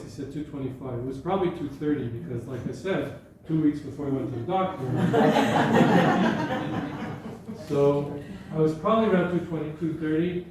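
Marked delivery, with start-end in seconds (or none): 4.9-7.6 breathy burst of laughter; laughing. Real, not acted. Laughs at own weight story.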